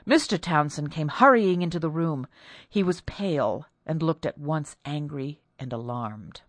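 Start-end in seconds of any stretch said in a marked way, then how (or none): none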